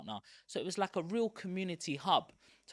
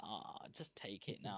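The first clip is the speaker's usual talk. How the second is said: much lower, softer and slow, defeated; resigned give-up; 8 semitones lower, 13 dB quieter than usual. Retold or imitated. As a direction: hand it over with a beaten sigh. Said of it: "you're like".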